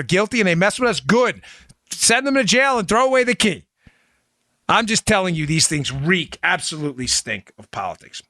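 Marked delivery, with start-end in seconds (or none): 6.4-8.2 dropped low and soft in disgust; low disgusted mutter. Real, not acted. Host closing the rant, soft low disgust. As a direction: let it sink, quietly disgusted. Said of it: "disgust after reek".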